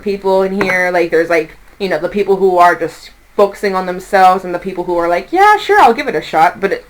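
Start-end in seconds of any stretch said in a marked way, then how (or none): 5.3-6.6 higher-pitched upbeat yes; game agreement. Acted. Tarot reader voicing willing clients, game agreement. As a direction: play someone happy to give it a go. Voices people willing to try.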